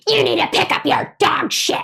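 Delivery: high, screechy scolding; mock shrieking complaint; imitated screaming. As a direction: screech it like an outraged stranger. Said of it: "she starts screaming at me".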